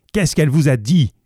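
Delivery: crie plus haut et plus fort, rapide; cri furieux. Acted. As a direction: jette la question en hurlant de rage. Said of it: "cria le vieillard furieux". (cri furieux et pressé)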